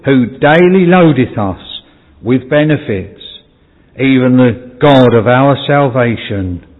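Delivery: slow, solemn reading of a psalm; scripture reading. Acted. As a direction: read the verse slowly and reverently. Scripture read again.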